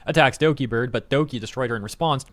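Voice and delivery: ordinary delivery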